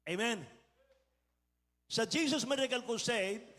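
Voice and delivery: ordinary talk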